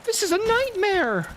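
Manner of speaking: high-pitched wail of despair; exasperated dismay. Real, not acted. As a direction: wail it high, overwhelmed by enemies. Overwhelmed by enemies in game.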